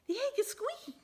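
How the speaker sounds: very high, excited squeal. Acted. Missus Bird hearing the egg squeak, high and excited. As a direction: squeal it with delight. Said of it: "she said".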